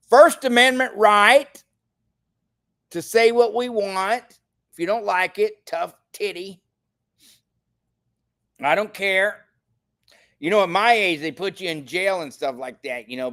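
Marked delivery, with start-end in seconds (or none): 0.1-9.4 higher, louder defiance; defiant outburst. Real, not acted. Vlogger defying critics of his free speech, raised and defiant. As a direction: throw it in their faces.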